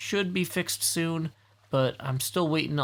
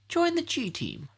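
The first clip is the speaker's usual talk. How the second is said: squeaky high voice; 13 semitones higher than usual. Pretend. Reading sign in put-on voice.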